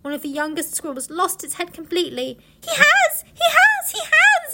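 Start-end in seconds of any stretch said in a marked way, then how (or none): 2.6-4.5 very high, loud frantic squeal; shrieky little squirrel in a frenzy; squeaking young squirrel. A narrator's reading. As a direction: squeak it hysterically like a tiny animal. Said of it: "it squeaked".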